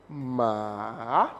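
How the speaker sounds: "ma" long and low, then swooping up; dipping third tone. Shown as a demonstration. Teacher showing M with A, "ma" falling then rising. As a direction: sink low on "ma", linger, then climb. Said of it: "Le troisième ton".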